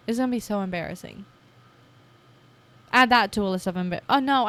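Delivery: normal talking voice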